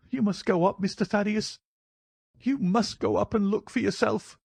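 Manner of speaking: high-pitched, worried servant; high, agitated character voice; distraught old housekeeper. Acted. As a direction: fret in a high old woman's voice.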